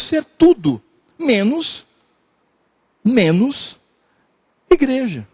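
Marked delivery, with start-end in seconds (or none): none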